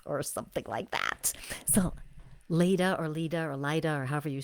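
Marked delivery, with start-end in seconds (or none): 0.0-1.3 low whisper tag-on; whispered throwaway; whispered aside. Real, not acted. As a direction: drop to a whisper to end it. Trailing aside.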